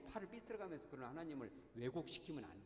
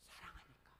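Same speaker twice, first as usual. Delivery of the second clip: a much softer, tender "사랑하니까" trailing down; tender answer; 12 dB quieter than usual. Real, not acted. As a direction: breathe "사랑하니까" out gently, almost to yourself. Answering why the father ran. (부드럽고 작게 "사랑하니까")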